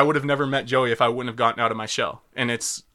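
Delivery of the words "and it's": ordinary talk, nothing marked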